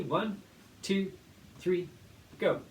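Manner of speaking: quick count-in ending on go; teacher counting in the class. Shown as a demonstration. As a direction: count them in, then launch.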